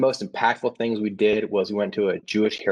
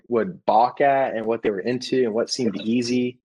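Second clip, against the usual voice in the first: usual speaking voice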